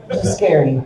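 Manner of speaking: ordinary talk, nothing marked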